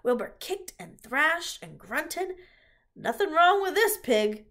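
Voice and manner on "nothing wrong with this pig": high, loud, hearty exclamation; upbeat farmer's raised voice; cheerful. A narrator's reading. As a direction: declare it cheerfully to everyone. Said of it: "said Zuckerman cheerfully".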